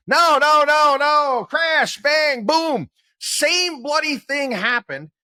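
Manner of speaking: high, loud, hammed-up alarm; mock panic. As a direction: shout it like the sky is falling. Mocking market panic outburst.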